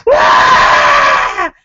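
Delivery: high, loud blood-curdling shriek; horror movie scream. Retold or imitated. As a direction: scream like a horror film victim. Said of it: "I scream like a horror movie scream".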